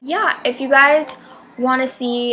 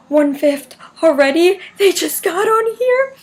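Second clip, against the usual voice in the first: surprised disbelief; 6 semitones higher than usual. Retold or imitated. Disbelief at concert progress.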